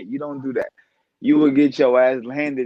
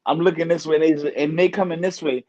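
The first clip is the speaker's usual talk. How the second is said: usual speaking voice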